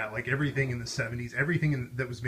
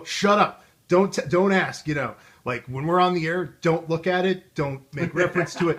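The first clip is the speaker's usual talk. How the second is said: high, loud hammed-up scolding; mock angry anchor; 6 semitones higher, 9 dB louder than usual. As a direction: snap at her to drop it, in a high loud voice. Anchor scolding co-host on air.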